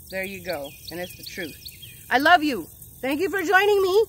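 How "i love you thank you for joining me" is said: loud, high, upbeat farewell; big bright goodbye to viewers; energetic sign-off. Real, not acted. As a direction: send them off pumped up.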